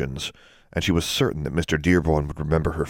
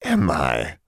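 hag voice. A narrator's reading. Hag answers coyly.

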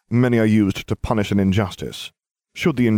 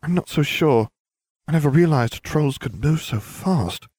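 1 Hermione's doubt; 8 semitones higher than usual. A narrator's reading.

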